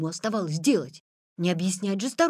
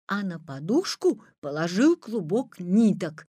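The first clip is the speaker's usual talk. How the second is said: ordinary delivery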